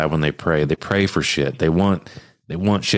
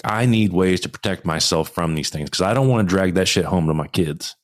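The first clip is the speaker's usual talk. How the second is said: normal talking voice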